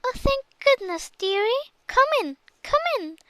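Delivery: put-on high, sweet old granny voice; a relieved grandma greeting her dear; Grandma's voice. A narrator's reading. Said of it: "said Grandma".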